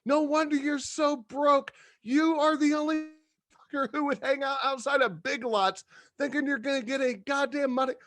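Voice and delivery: very high-pitched mock tirade; acted outraged, strained high rant; irate retold rant. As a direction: yell after him, utterly exasperated. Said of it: "I was so irate".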